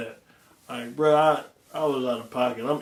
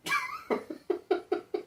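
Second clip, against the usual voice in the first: high laugh; 6 dB quieter than usual. Real, not acted. Laughing about drinking.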